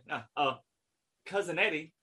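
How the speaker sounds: normal talking voice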